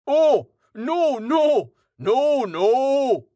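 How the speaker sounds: loud, alarmed bellow; roar. A narrator's reading. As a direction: roar it out high and loud, stretching each no. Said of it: "the human roared".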